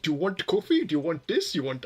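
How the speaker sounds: eager vendor imitation in an Indian accent; rising questions in a put-on Indian accent; mock Indian accent. Imitating merchants offering coffee, mock Indian accent. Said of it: "go back into the accent".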